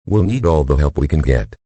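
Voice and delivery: ordinary talk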